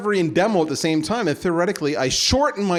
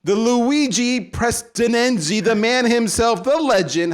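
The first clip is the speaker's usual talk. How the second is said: announcer intro; 5 semitones higher, slower than usual. Acted. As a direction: build the guest up like a ring announcer. Grand guest introduction.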